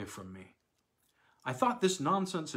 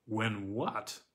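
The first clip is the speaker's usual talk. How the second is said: usual speaking voice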